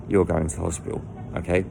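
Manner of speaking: usual speaking voice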